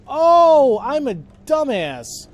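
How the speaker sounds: loud, high drawn-out cry of realization; exasperated realization. Real, not acted. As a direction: kick yourself as it finally clicks. Sudden realization of own mistake.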